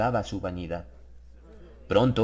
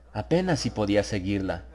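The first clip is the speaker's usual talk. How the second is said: ordinary delivery, nothing marked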